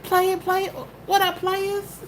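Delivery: high, laid-back cool-guy greeting; cooler player greeting. Pretend. Showing how the farmer should greet players, high and smooth. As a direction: say it like you just popped your collar. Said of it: "you walking like".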